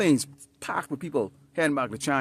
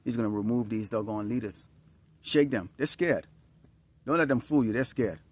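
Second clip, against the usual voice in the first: normal talking voice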